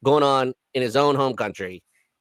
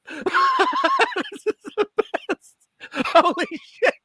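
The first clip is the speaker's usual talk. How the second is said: laughing; 13 semitones higher, slower than usual. Real, not acted. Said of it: "cracking up at the video".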